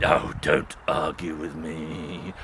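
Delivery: deep, slow, bored wolf; low wolf voice. Acted. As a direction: brush the pig off, very low. Wolf cutting pig off.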